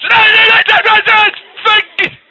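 high repeated loud nos; loud protest. Acted. Character in the story protesting loudly, high-pitched. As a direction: keep shouting no, no, no.

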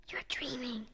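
dismissive whisper. Acted. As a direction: whisper it back, unconvinced. Whispered reply in dark.

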